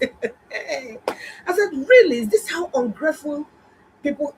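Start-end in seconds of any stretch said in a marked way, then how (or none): 1.7-4.3 high, aghast disbelief; inner outrage. Retold or imitated. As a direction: gasp at the ingratitude. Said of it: "Inside me, that's what I said".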